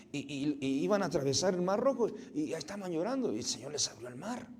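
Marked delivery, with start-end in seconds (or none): none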